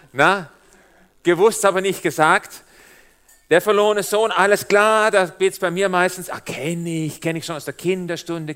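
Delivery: ordinary talk, nothing marked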